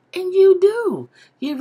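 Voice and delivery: a loud, high insistence; emphatic shout. Real, not acted. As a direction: belt it out to insist.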